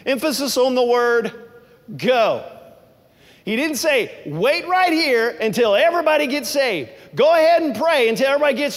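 high, loud preaching build to a peak; full-force preaching. Acted. Pastor urging the congregation to go, preaching at full force. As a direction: thunder it out like a revival preacher.

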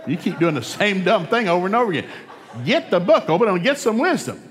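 loud, high-pitched preacher's exhortation; forceful preaching. Acted. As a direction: drive it home at full preaching volume.

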